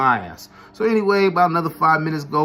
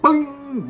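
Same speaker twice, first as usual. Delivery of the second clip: imitated impact sound; 11 semitones higher than usual. Ball hits girl's head.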